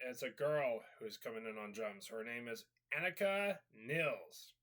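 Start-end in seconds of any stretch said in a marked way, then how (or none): none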